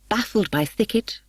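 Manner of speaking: ordinary delivery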